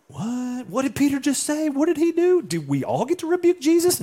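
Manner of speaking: very high-pitched, astonished squeal of questions; acted shock. Disciples reacting to Peter rebuking Jesus, stunned. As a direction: play scandalized disciples, voice way up.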